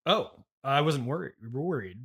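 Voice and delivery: ordinary delivery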